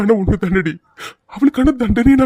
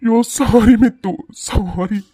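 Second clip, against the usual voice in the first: slow, sobbing sorry; crying apology; much slower than usual. Acted. Husband to his injured wife Mithu, slow sobbing apology. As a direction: beg forgiveness through tears, slowly.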